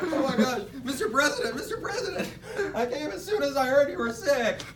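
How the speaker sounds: high, frantic worried cry; mock panic. Herbert rushing to the sick president, high and frantic. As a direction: rush in as if he's dying.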